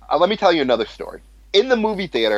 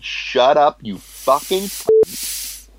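drawn-out, higher-pitched retold outburst; retold angry insult; 3 semitones higher, much slower than usual. Manager repeating his insult to the yelling customer, angry and drawn out. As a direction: repeat the insult slowly, stretching the last words.

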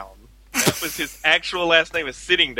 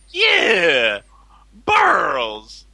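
cartoonish Fat Albert impression of a customer; retelling a pharmacy customer as Fat Albert; 3 semitones higher, 4 dB louder than usual. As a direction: put on Fat Albert's voice, a bit higher. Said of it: "adopted Fat Albert's voice".

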